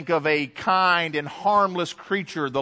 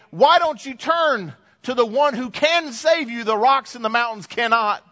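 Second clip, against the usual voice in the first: pleading exhortation; 8 semitones higher, 3 dB louder than usual. Retold or imitated. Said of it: "we read this passage going".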